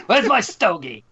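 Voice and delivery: put-on gruff wisecracking baby; brash little tough-guy baby; Baby Herman voice. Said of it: "Baby Herman?".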